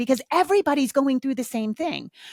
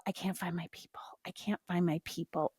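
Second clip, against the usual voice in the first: whispered, lower and much softer; whispered lament; 4 semitones lower, 12 dB quieter than usual. Acted. Lonely person mourning having no people, whispered. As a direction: breathe it out like a lonely secret.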